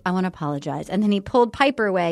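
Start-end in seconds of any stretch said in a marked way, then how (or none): 0.0-0.9 low, soft mock-bachelor voice; imitated apologetic bachelor. Bachelor comes to apologize to the women, imitated low and soft. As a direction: drop deep and soft for his apology. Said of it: "he's like".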